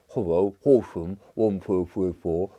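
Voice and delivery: muffled, mushy words as if through a mouthful; plum in mouth. Pretend. Mocking posh phone answer, talking round a plum. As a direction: mumble it round a plum stuck in your mouth. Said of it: "if you had a plum in your mouth".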